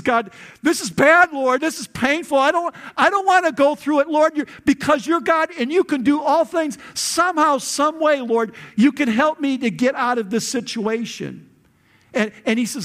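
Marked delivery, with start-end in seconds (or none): 0.0-11.5 acted desperate plea, high and loud; pleading prayer. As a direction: beg God urgently, voice pitched right up. Acted agonized prayer of Jesus.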